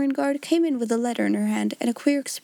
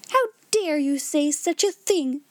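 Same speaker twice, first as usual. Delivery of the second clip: higher, slow, outraged reproach; indignant exclamation; 5 semitones higher than usual. A narrator's reading. Headmistress rebukes her timid sister, indignant exclamation. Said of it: "exclaimed Miss Minchin".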